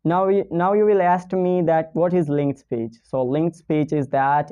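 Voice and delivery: ordinary talk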